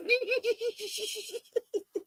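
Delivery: a long, high squealing laugh with breath in it. Real, not acted. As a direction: let a shrill giggle burst out. Laughs at her own story.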